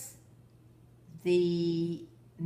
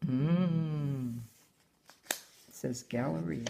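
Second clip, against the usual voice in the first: ordinary delivery, nothing marked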